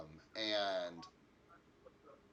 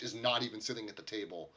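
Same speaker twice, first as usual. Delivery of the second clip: ordinary delivery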